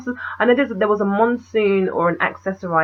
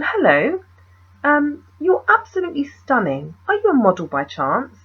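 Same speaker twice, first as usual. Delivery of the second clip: imitating an eloquent British chat-up, higher pitched; eloquent British accent; 6 semitones higher than usual. Photographer chatting up a stranger, eloquent British accent. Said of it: "eloquent British accent".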